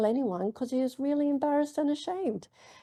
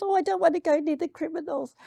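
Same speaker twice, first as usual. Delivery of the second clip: high-pitched imitation of a reluctant child; little girl's voice, pitched up and reluctant; young daughter; 8 semitones higher, faster than usual. Young daughter balks at going near the criminals, high child voice. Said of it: "my young daughter".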